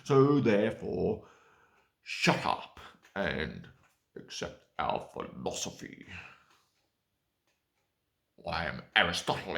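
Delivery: loud, lecturing ancient philosopher; pompous Aristotle. Retold or imitated. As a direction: boom it out like a smug philosopher. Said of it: "That's the sort of shit they probably thought".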